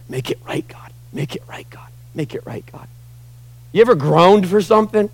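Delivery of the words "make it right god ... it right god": breathless whispered plea, voice dropped away; groaning whisper. Acted. Believers groaning a prayer under their breath, whispered. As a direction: whisper it like a private groan to God. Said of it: "We're going".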